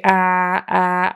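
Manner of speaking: ah, ah as a short open a; short a sound. Shown as a demonstration. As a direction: open the mouth wide for ah. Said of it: "a short A sound".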